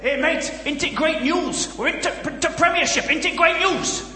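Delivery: put-on high, excited Huddersfield accent. Overjoyed football fan racing up to a passer-by, high Huddersfield accent. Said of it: "quite a good Huddersfield accent".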